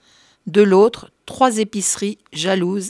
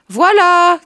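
call out; 10 semitones higher, 9 dB louder than usual. Acted. Cria le curé dans le couloir.

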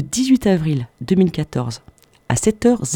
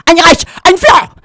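much higher, louder, slow bellowed slogan; carried-away shout; 14 semitones higher, 10 dB louder than usual. Acted. Radio host getting carried away with a German slogan, bellowed. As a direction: bark it out like a rally chant. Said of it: "je m'emporte".

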